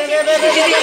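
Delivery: sharp shouted rebuke at the monkey; rising into a scolding yell; loud scolding shout. Real, not acted. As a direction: yell it to stop him. Scolding the monkey, louder take.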